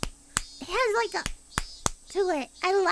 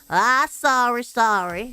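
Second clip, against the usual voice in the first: put-on low, loud country accent; 6 semitones lower, 4 dB louder, slower than usual. Landlord says sorry to the housewife, country accent. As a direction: apologise in a deep country twang. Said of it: "I'll do a country sour".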